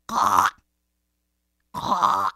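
loud, harsh crow call; crow caw. Acted. Crow sitting in a tree, cawing loudly.

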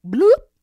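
high, loud "bloop"; comic plop sound. Acted. Snake dives into a hole, popped bloop. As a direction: pop it out suddenly.

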